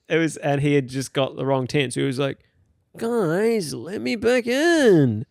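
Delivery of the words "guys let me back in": put-on high, slurred drunk pleading to be let in; drunk guy voice. Drunk stranger begging tentmates to let him in, slurred and high. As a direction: play the hammered guy begging at the wrong tent.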